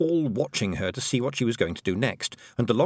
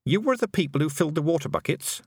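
higher questioning voice for the heroine; lightly voiced Tiffany, stern; 7 semitones higher than usual. A narrator's reading. Girl confronting the tiny men, lightly voiced and stern. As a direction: question them firmly, pitched up.

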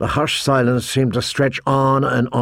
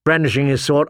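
ordinary delivery, nothing marked

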